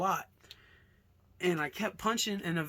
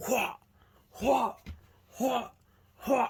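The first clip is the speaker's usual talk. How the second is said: short, separate effort cries; imitated punch noises; 6 semitones higher, 4 dB louder than usual. Storyteller acting out ground and pound, punch noises. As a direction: act out each blow with your voice.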